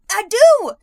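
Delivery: high, loud "I do!" after a cough; loud indignant protest. Real, not acted. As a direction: shout it back, offended. Protesting she has long covid.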